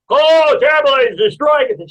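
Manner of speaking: loud, high-pitched mock horror; horrified outcry. Onlooker watching the baker ruin everything, horrified outcry. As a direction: wail in hammed-up dismay.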